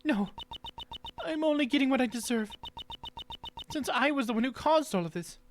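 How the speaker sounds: high-pitched, remorseful character voice; apologetic game character. Acted. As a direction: lift the voice high for a sincere apology. Same character accepts blame.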